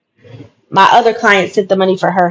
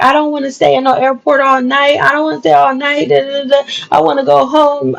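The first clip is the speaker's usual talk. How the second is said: put-on higher, whiny little boy pleading; imitating crying son; 5 semitones higher than usual. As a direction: mimic a crying child begging to go home. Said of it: "he started crying".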